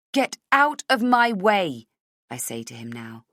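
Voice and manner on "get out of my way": slow, loud forced bravado; false bravado. Acted. Heroine facing villain, false bravado. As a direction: fake the courage, push it out. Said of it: "with false bravado".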